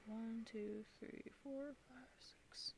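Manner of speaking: soft counting, fading to whisper; counting under breath. Real, not acted. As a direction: count quietly to yourself.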